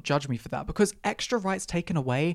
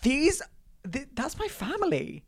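high, breaking, hammed-up sob voice; a touch of fake tears; mock tearful; 10 semitones higher than usual. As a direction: pretend to choke up over lost family. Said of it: "I was close to tears".

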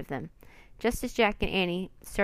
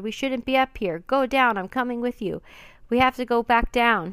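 loud, higher urgent shouting; 4 semitones higher, 7 dB louder than usual. Acted. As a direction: push her to go back down, yelling. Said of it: "he shouted".

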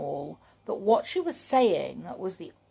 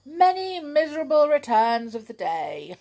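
laughing; 8 semitones higher, 7 dB louder than usual. Acted. Reading the cheeky card laughing.

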